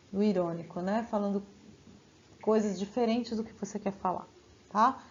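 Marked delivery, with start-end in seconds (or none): none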